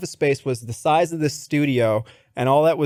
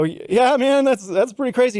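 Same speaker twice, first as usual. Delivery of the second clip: high, faltering agreement; uneasy agreement; 9 semitones higher than usual. Retold or imitated. Candidate humors a laughing boss, uneasily agreeing. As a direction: play along awkwardly, pitched well up.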